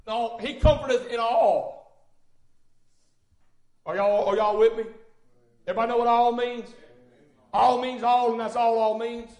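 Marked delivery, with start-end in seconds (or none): none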